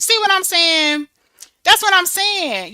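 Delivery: high, loud, slowed emphasis; emphatic. Real, not acted. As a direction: drive the point home. Emphatic rhetorical questions.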